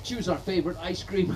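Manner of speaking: ordinary delivery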